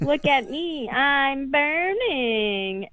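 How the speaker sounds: put-on high, very slow otherworldly child's voice; eerie ghost girl. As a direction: drag it out like a spooky burning child. Retelling a ghost girl's line.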